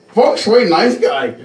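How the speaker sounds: high mimicking voice, talking in third person; high caricature of a self-announcing handyman; Feng Shui. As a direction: parody an acquaintance praising himself, pitched high. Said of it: "speaks to themselves in a third per".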